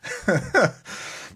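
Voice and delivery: high-pitched rising giggle. Real, not acted. Laughing at own advice.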